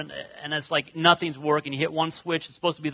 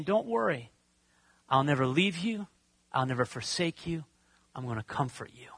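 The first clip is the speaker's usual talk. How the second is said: gentle comfort; 4 semitones lower, 7 dB quieter than usual. Acted. Voicing Jesus comforting the listener.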